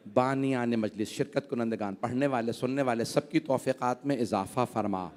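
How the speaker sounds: hushed closing dua; prayer. Acted. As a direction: pray quietly and humbly for all present. Closing supplication to God.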